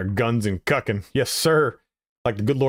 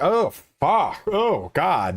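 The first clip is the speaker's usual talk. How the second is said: high-pitched exaggerated groaning dismay; mock dismay; 8 semitones higher, much slower than usual. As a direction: recoil in hammed-up horror. Acting out dismay at accent.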